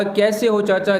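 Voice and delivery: ordinary talk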